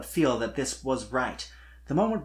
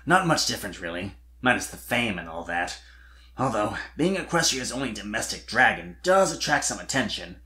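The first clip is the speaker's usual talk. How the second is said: a hint of a shy dragon boy; Spike voiced; 3 semitones lower than usual. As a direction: lightly lower young dragon voice. Spike's lines in fan fiction reading.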